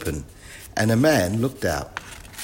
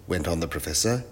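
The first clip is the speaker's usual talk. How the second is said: plain reading voice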